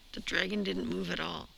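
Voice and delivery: ordinary delivery, nothing marked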